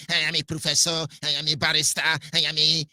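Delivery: a lightly voiced, puffed-up professor; mock self-important boast. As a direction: imitate the boasting with mild disdain. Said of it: "that's how I say".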